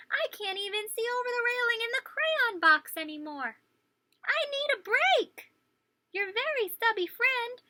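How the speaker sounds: high, loud, worn-out little crayon; Blue Crayon voice. Acted. Teacher voicing a tired stubby crayon to kids, high and loud. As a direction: pitch way up and plead for a break.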